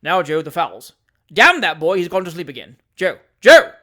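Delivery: loud impatient yell; shouting for the boy. A narrator's reading. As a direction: bellow to wake the sleeping boy. Wardle shouts at sleeping boy.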